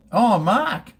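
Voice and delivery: high and loud eager call; delighted high call to Mark; excited greeting. Real, not acted. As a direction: greet him with bright surprise.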